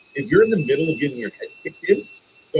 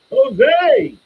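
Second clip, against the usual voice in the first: calling out; 9 dB louder than usual. Real, not acted. Greeting a chat member by name.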